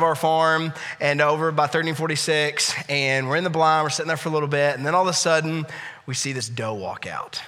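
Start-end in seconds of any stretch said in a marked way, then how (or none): 6.1-7.4 whisper. Retold or imitated. Hunting blind, keeping quiet.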